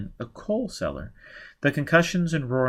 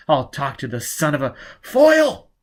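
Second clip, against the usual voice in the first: louder furious snap; angry outburst; 3 semitones higher, 6 dB louder than usual. Acted. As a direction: she bursts out at him. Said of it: "Gisbella burst out".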